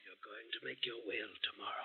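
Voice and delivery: soft whispered command; ghostly whisper. Acted. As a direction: whisper the warning. Ghost instructs Elizabeth.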